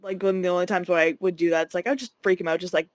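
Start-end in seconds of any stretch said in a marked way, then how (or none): none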